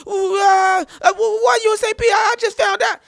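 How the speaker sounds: high, loud, stammering mock panic; hammed-up alarmed shouting; panicked caller. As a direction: shriek it like a frantic friend phoning. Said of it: "you also causing panic".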